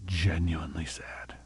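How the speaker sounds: breathy whispered words. Shown as a demonstration. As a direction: hush it to a whisper. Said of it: "sorry to talk like that".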